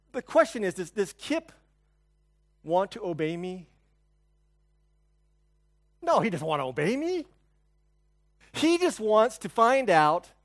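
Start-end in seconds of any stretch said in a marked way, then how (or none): none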